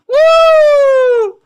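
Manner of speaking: very loud, very high shouted woo; celebrating whoop. Acted. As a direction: let rip a huge victory whoop. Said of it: "I keep making that woo sound".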